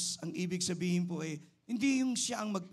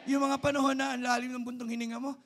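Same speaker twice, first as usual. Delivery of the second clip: high, very loud preacher's shout; full-force preaching; 10 semitones higher, 11 dB louder than usual. Real, not acted. Preacher calling congregation to say amen, high full-force shout. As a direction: cry it out to rouse the congregation.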